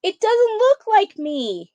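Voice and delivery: outraged roar at the portrait. Acted. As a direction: bellow it like an offended president. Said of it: "he roared".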